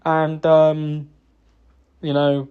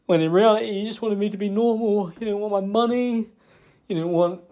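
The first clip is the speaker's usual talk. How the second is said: normal talking voice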